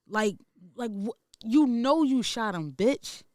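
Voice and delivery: slow, pressed, rising in heat; real anger. Real, not acted. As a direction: spit it out at her, fed up. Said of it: "it get me so mad".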